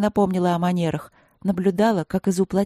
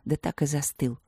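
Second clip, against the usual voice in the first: plain reading voice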